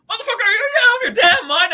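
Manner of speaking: an imitation of a stoic hero suddenly yelling; Blade breaking character. Friend retells how Blade suddenly yells at the cops, imitating a high, fast, loud shout. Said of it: "he's acting all serious and dark and gruff voice, and then he goes".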